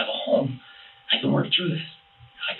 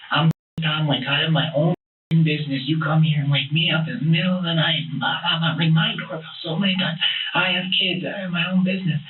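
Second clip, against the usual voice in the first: retold angry rant; 5 semitones higher than usual. Tom rants at late-night visitors, mildly mock angry. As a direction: imitate Tom going off. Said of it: "just went off and off".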